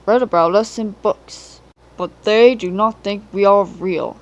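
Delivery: loud, commanding speech to the engines; booming Fat Controller. A narrator's reading. The Fat Controller announces his plan, loud and commanding. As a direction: address the whole shed with authority.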